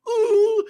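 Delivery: very high, loud mock whimper "ooh"; pitiful whine. Host imitating sad withered green beans, pitiful mock whine.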